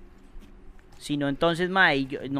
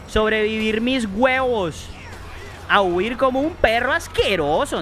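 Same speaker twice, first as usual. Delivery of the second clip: panicked yelling; 7 semitones higher than usual. Real, not acted. Gamer fleeing a monster, screaming in panic. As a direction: yell like you're running for your life.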